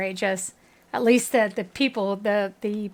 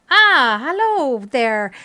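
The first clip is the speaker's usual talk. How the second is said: put-on lightly German-accented greeting, loud and slow; a loud, drawn-out hello in a light German accent; 2 semitones higher, 9 dB louder, much slower than usual. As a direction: villager hailing a stranger in a light German accent. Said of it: "that German accent when you said".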